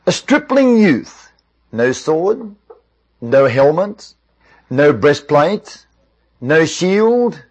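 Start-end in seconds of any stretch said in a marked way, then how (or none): 0.1-1.3 high, slow, drawn-out scoff; scornful disbelief. Retold or imitated. Soldiers scoffing at the shepherd boy, scornfully incredulous. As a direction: voice the men's mock amazement, pitched high. Men scoffing at David's offer.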